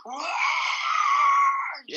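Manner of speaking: high shrieking cry; mock wail. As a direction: shriek like a hurt kid. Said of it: "They're like".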